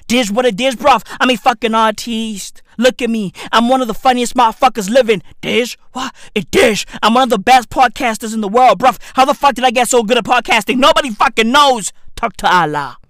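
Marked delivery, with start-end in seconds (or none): 3.5-11.9 rapid-fire boasting rant; fast, run-on bragging; speaking fast. Real, not acted. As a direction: rattle it off like a speed rapper. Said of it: "I'm out here speaking fast".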